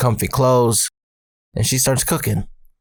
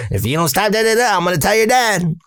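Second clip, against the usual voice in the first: high-pitched nagging warning; mom's threat; 10 semitones higher, faster than usual. Retold or imitated. Mom threatens to tell dad, high scolding voice. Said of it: "mom always says".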